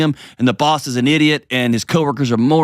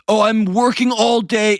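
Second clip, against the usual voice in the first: mock excuse; 12 semitones higher, slower than usual. Mocking guys' excuse.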